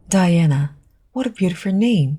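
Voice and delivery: ordinary delivery, nothing marked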